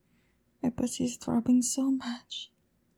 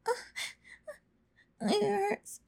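pained whimper; 6 semitones higher, 3 dB quieter than usual. Acted. Pained moan in scene.

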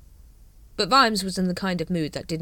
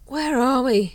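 higher, louder groaning question; groaned; 8 semitones higher, 7 dB louder than usual. Acted. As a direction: groan it, head muzzy. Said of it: "he groaned".

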